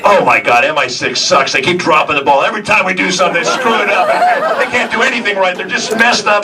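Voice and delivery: put-on loud, high Southern-girl griping; hammed-up outburst of complaint, pitch rising; mock griping rant. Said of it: "all three of them said".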